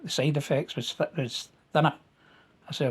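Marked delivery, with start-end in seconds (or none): none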